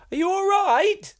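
high sing-song call of "Are you all right?"; friends calling out. Retold or imitated. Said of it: "my friends' calls of".

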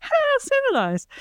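very high prim voice; hammed-up high posh remark; mock posh. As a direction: trill it like a prim lady. Mocking echo of civilized.

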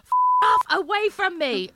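loud, very high-pitched yell; shouting out. Acted. Woman shouting at balcony pigeons, neighbours listening, shouting. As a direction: scream it out at the pigeons. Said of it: "shouting out at different intervals".

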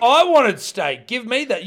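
loud, high playful protest; mock angry demand. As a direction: join in with a hammed-up holler. Co-host joins the acted steak protest.

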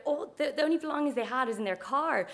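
ordinary delivery

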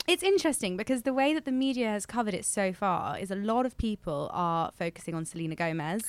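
ordinary talk, nothing marked

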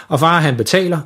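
usual speaking voice

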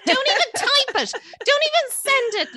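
high, loud laughing plea; mock plea. Host begging listeners to stop messaging, laughing plea. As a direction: beg the listeners through laughter.

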